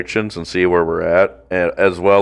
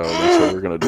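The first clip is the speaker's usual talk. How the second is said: high squeaky softer voice; 3 dB quieter, faster than usual. Acted. Host finishing the butt joke in a squeak. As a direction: pipe it up absurdly.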